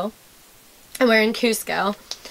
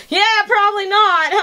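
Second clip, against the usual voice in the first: imitating local guy; 8 semitones higher, 3 dB louder than usual. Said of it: "And he's like".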